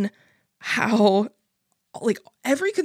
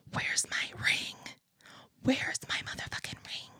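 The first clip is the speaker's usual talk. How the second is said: intense whisper, much softer; whispered demand; 13 dB quieter than usual. Retold or imitated. Demanding her engagement ring from Anthony, fierce whisper. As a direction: hiss the demand under your breath.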